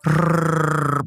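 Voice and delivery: long rolled R sound effect; imitated slithering-down noise; rolled trill noise. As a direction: trill the tongue for a collapsing body. Imitates body going limp.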